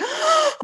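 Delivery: sharp high gasp of discovery; a quick excited intake of breath; delighted gasp. Retold or imitated. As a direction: gasp like you just found treasure. Remembering forgotten snacks.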